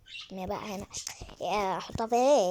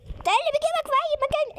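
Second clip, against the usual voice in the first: much higher, exasperated disbelief; exasperated high cry; 3 dB louder than usual. Real, not acted. Exasperated at the baby. (دهشة عالية مستنكرة)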